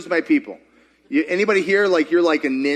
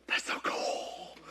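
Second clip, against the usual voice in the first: whisper; 15 dB quieter, slower than usual. Retold or imitated. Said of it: "you're like".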